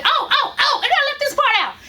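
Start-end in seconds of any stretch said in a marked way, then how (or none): none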